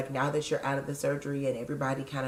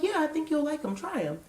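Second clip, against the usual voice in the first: chirpy, much higher mock coworker voice; hammed-up health-food friend urging; upbeat co-counselor; 12 semitones higher than usual. Imitating co-counselor pushing kale chips in a high chirpy voice. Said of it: "so she's like".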